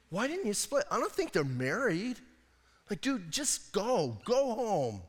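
dude, go home; exasperated. Real, not acted. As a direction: tell him to just leave. Urging Samson to leave.

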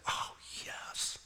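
hushed, breathy oh yes of delight; a soft whispered oh yes of pleasure; savoring whisper. Pretend. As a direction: whisper it like tasting something delicious. Savoring luscious fruit.

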